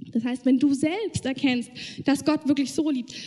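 normal talking voice

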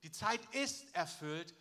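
slow, with the weight on "ist"; emphatic, drawn-out "ist"; stress on IST. Shown as a demonstration. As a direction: lean firmly on "ist", unhurried. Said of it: "möchte ich betonen".

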